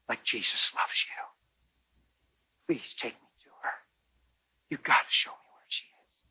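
soft, near-whispered pleading; teary plea. Retold or imitated. Pastor softly begging the woman to take him to the girl. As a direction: beg her gently through tears.

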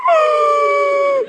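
long high mock cry; high-pitched wail. Imitates own wail on being charged.

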